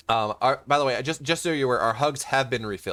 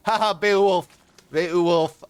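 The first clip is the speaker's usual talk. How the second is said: louder, higher teasing imitation; mocking chat; 6 semitones higher, 6 dB louder, slower than usual. Caster imitates a gloating viewer in chat, teasing voice. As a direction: ham up a gloating chatter. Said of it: "Is he like".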